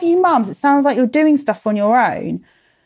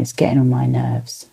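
muttered whisper; 8 semitones lower than usual. Real, not acted. Annoyed aside at failing phone.

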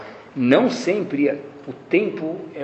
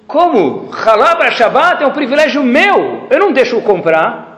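indignant grandmother; 8 semitones higher, 5 dB louder, slower than usual. Retold or imitated. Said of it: "avós que até ficam chateadas".

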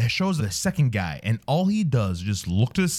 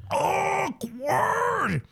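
high exasperated wail. Acted. Exasperated cry at the ads.

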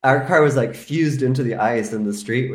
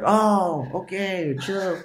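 mock relaxed officer; 9 semitones higher, much slower than usual. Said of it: "And they're like".

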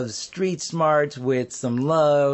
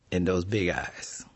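plain reading voice

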